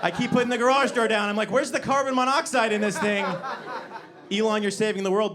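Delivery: gleefully unhinged dark joke; manic laughing. Acted. Comic cackling about the garage door to the crowd.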